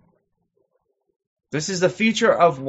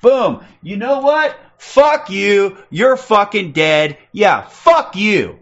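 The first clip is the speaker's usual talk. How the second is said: higher, louder pretend confrontation; imagined furious retort, pitched up and loud; mock angry outburst; 6 semitones higher, 5 dB louder, slower than usual. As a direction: act out snapping back at someone. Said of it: "until we go".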